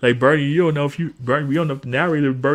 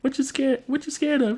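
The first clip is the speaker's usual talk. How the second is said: Bernie asking; 11 semitones higher, 4 dB quieter than usual. Retold or imitated. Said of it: "Bernie asks her".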